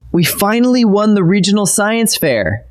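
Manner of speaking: bright, high burst of pride; excited exclamation. Shown as a demonstration. Teacher acting out a proud science fair win, excited. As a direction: announce the win like you can't contain it. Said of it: "Feel the punch of that exclamation point".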